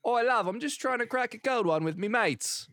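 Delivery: squeaky cheeky geezer voice; mock British bloke.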